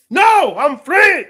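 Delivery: loud, slow, high proclamation; defiant. Acted. Answering his imagined critic, loud and slow. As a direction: declare it proudly and slowly.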